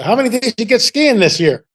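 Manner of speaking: imitating older skier. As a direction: lightly act out a curious stranger. Voices the older skier's question.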